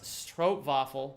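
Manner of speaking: "Stroopwafel" slowly, with the w as a v; correct Dutch pronunciation. Shown as a demonstration. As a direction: demonstrate "Stroopwafel" carefully, "strope-vah-fel". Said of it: "Please pronounce Stroopwafel correctly".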